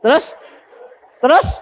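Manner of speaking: high, loud prompting question; prodding. Real, not acted. Preacher prodding the congregation, high and loud. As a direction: push the crowd to guess, rising.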